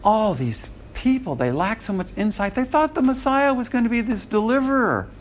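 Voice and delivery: high, sneering put-on voice of a know-it-all critic; mocking know-it-all. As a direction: play the smug scoffer looking down on them. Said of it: "people say".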